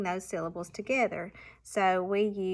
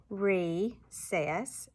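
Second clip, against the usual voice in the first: "recess" in two separate beats, re, then cess; evenly spaced syllables in "recess"; chopped syllables; 3 dB quieter than usual. Shown as a demonstration. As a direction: chop "recess" slowly at the syllable break. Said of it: "chop those syllables".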